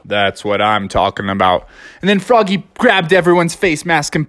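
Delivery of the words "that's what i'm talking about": lower, droning Eeyore gloom; gloomy Eeyore. Acted. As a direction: sigh it out like Eeyore. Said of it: "And Eeyore's like".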